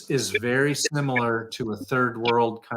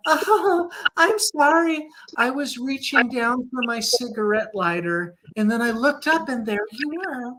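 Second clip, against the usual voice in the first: much higher, giggly apologetic woman voice; mocking nervous woman; 8 semitones higher than usual. Mocking the driver who rear-ended him, high and nervous. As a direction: mimic her flustered, nervous excuse with a high pitch. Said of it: "she gives me a nervous laugh. She goes".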